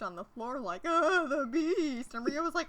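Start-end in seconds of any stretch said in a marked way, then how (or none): 0.8-2.1 high, very slow swooning wail; hammed-up delirious groan; mock seizure. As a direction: moan it while fake-twitching on the floor. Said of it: "starts to seize up and, like, twitch".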